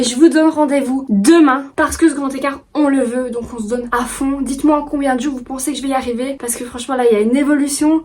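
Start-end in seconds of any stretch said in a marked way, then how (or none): none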